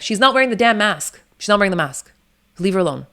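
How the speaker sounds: loud, high protective shout; snapping at hospital staff to back off, loud and high; retold shout. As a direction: shout back at the staff to leave her alone. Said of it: "I'm like".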